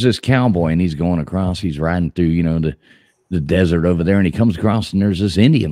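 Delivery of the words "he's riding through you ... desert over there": normal talking voice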